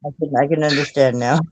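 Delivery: ordinary delivery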